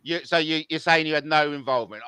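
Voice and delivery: ordinary delivery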